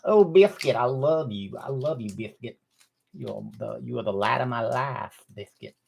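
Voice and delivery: put-on lightly done Dusty Rhodes accent, softening as it goes. Owner cooing to his little dog in a light Dusty Rhodes accent. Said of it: "use my Dusty Rhodes accent".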